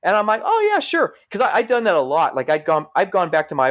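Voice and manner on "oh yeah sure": much higher, bright eager agreement; eager yes. Retold or imitated. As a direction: jump at the offer, pitch shooting up. Agreeing eagerly to daughter's request.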